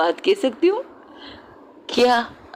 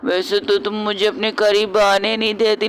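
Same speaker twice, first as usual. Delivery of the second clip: usual speaking voice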